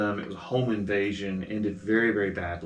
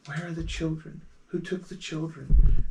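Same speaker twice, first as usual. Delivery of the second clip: a lost mother searching; eerie wailing question; ghostly woman's voice; 6 semitones higher than usual. Acted. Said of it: "a woman's voice saying".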